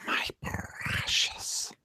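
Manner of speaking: slow, whispered creature hiss; Gollum. Shown as a demonstration. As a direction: whisper it like a creepy, covetous creature. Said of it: "when guys talk Gollum".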